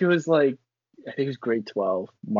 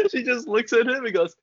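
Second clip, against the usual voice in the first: normal talking voice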